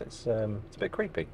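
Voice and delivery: ordinary talk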